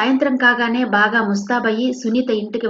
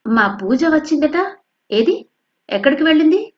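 feigned innocence; 7 semitones higher, 4 dB louder than usual. Acted. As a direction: act as if unaware. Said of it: "ఏం తెలియనట్టే అడిగింది".

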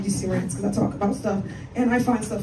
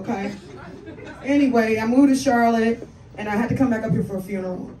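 ordinary delivery

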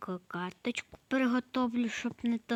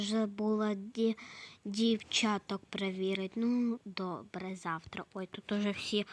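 ordinary talk, nothing marked

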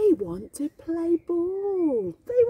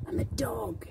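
whisper; 7 semitones lower, 5 dB quieter than usual. Acted. Aside while reading to child.

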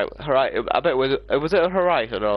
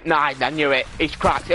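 ordinary talk

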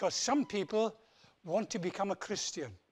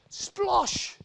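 splash sound; 12 semitones higher, 9 dB louder than usual. Acted. Jumps into paint.

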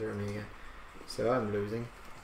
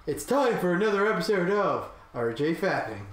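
loud, higher episode intro; mock show announcer; 6 semitones higher, 5 dB louder than usual. Player announcing another episode of his show, mock announcer. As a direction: announce it like a cheesy show host.

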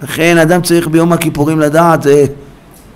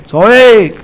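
higher, emphatic call; raised cry; 6 semitones higher, 3 dB louder, much slower than usual. Shown as a demonstration. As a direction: lift the word into a cry. Acts out the one shouting. (צעקה)

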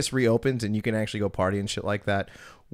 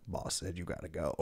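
low, very soft order; understated bouncer; 4 semitones lower, 12 dB quieter than usual. Retold or imitated. Bouncer tells an unruly guest to leave, low and quiet. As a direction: quietly lay down the boss's word.

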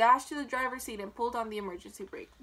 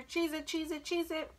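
high, repetitive gander alarm; shouting gander; 5 semitones higher than usual. A narrator's reading. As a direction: chant the warning, pitched up. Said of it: "shouted the gander".

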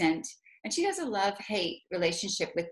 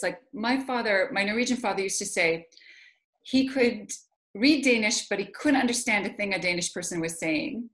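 ordinary delivery

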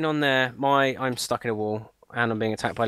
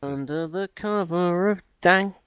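mock dramatic; 6 semitones higher, slower than usual. Playful sung-like line while playing.

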